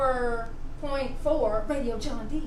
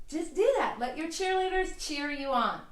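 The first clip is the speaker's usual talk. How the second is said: ordinary talk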